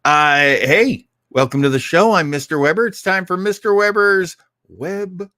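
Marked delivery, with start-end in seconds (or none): none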